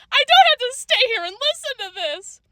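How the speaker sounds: high-pitched tearful protest; bawling. A narrator's reading. Sandra refuses to stay and listen, bawling. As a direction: whine it high, close to bawling. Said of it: "Sandra bawled".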